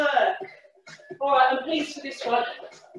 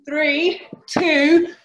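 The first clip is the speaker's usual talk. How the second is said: countdown building to a shout; 6 dB louder, much slower than usual. Real, not acted. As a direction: bark out the last numbers. Coach calling final countdown.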